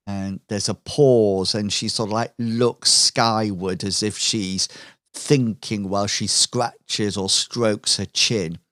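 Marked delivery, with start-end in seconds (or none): none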